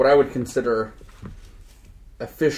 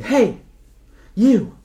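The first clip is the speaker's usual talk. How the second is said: high, loud call after someone; 9 semitones higher, 6 dB louder than usual. Retold or imitated. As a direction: shout after him to stop him, pitched high. Torith calling after the guy.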